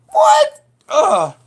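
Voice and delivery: shocked cry. Retold or imitated. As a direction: shriek it in disbelief. Her own shocked reaction to the film.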